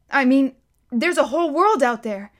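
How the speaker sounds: put-on a boy marvelling at the world; wondering boy. A narrator's reading. Bod voiced, Silas echoes.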